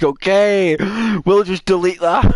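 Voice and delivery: high, loud mock customer service agent; hammed-up dismissive customer service voice; dismissive advisor. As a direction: brush it off like a bored call-centre worker. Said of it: "customer service advisor just looked at it and was like".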